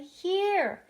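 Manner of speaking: high, very loud, drawn-out reply; exasperated call. A narrator's reading. Invisible boy answers father yet again, exasperated yell. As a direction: belt it out so he finally hears.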